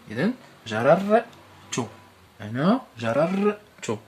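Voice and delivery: teacher drilling the split letters of جررت; emphatic drill. Shown as a demonstration. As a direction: stress every letter of جررت. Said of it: "لاحظ جيدًا".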